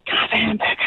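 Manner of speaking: whispered request, no voice. Acted. The guy whispers his order to the librarian. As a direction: hush it like you're in a library.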